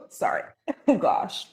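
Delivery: coughing after a sip went down wrong; choking on iced coffee; swallowed wrong way. Real, not acted. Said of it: "oh, it went down the wrong pipe".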